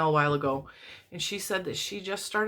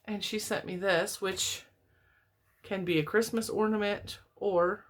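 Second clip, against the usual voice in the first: ordinary talk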